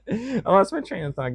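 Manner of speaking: high-pitched, laughing through the words. Real, not acted. Vlogger admits losing his thread to viewers, laughing.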